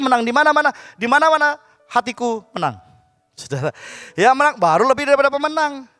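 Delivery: loud fiery preaching; preaching at full force. Acted. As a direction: drive the message home to the church. Preaching on victory in Christ. (khotbah berapi-api dengan suara lantang)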